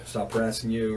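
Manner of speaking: usual speaking voice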